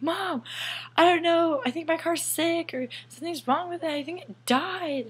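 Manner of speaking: high, whiny distressed plea; calling her mother in a panic; upset to mom. Retold or imitated. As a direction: whimper it to mom like a kid.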